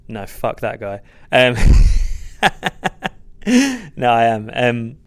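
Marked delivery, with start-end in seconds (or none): 1.6-3.9 high, loud laugh; sudden loud laugh at the question; a loud laughing burst before answering; burst of laughter. Real, not acted.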